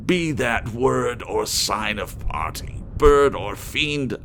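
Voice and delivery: high, loud outburst; shrieked. Acted. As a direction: fling it at the bird in fury. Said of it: "I shrieked".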